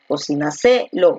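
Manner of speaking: Cocináselo slowly, stressed on SE; stress on fourth syllable. Shown as a demonstration. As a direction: drag the weight onto SE in Cocináselo. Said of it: "¿Dónde está la fuerza de voz".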